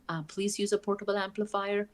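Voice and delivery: ordinary delivery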